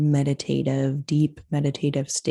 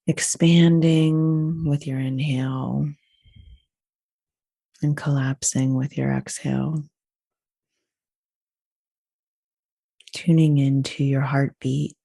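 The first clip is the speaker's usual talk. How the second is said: guided meditation. Acted. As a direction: lead them unhurriedly through each breath. Leads breathing meditation.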